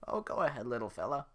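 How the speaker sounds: put-on old woman nudging her donkey to talk; coaxing old woman.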